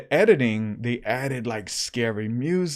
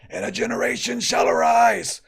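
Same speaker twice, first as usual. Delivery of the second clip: high, loud fiery preaching; preacher impression; 9 semitones higher, 4 dB louder than usual. Imitating the preacher Lou Engle in full fervor. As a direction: thunder it out like a revival preacher. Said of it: "he rocks when he prays".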